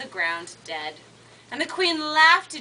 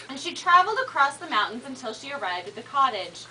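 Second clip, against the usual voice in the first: usual speaking voice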